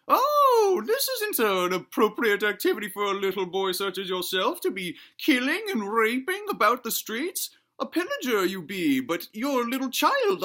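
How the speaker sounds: very high, put-on old granny voice; scolding granny. Granny scolds a little boy over his violent game, scolding. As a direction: falsetto granny tut-tutting. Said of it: "Granny Juice pops in ... she's saying".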